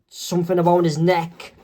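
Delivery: ordinary delivery, nothing marked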